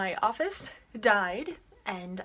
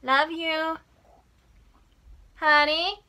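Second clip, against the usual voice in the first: calling out; 8 semitones higher, 15 dB louder, slower than usual. Real, not acted. Calling to partner affectionately.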